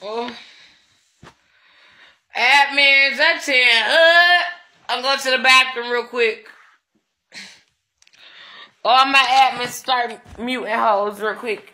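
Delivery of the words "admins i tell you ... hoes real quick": loud, high call to the admins. Real, not acted. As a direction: shout the orders across the room. Calling out orders to admins.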